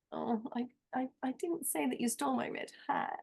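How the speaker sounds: hushed delivery. Shown as a demonstration. Workshop participant trying the sentence with a different emotion, very softly. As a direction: pull the volume right down and let it fall away. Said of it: "I could try".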